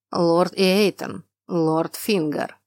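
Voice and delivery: slow, drawn-out summons; slow calling out. Acted. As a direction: call the names slowly. Duke calls his advisors.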